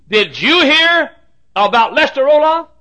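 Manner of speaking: high, slow, loud mock query; loud gossip. Famous preacher passing on a rumor, loud gossip. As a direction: blare the rumor as a drawn-out question.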